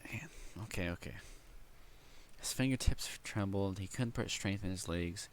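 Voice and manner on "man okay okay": breathy whisper to himself. Real, not acted. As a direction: whisper it, gathering yourself. Reader collecting himself after emotion.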